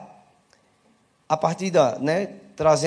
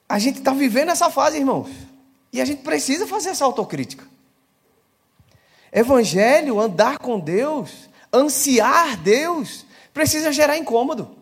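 higher, louder fervent preaching voice, slowing on key words; rising preaching; 8 semitones higher, 4 dB louder than usual. Acted. Preacher urging the brothers and sisters, in a fervent, rising voice. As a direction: drive the closing call home to the church.

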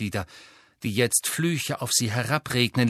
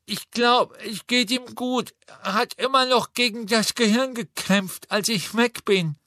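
Neville calling out; 10 semitones higher than usual. A narrator's reading. Neville calling down to Harry, brave boyish cry. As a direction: call down bravely.

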